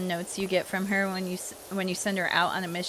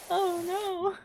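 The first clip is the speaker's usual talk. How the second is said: mock scandalized; 11 semitones higher than usual. Real, not acted. Reacting to own suggestive joke.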